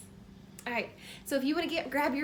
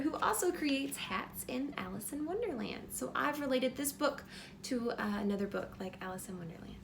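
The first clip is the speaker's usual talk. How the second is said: usual speaking voice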